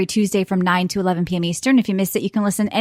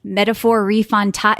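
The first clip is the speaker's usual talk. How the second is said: usual speaking voice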